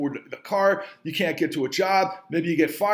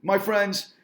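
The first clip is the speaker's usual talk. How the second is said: ordinary delivery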